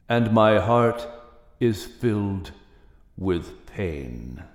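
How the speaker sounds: grieving God speaking from the flame; voice from the fire. A narrator's reading. God speaks to Noah from the fire, low and grieved. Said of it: "the voice which spoke through the fire".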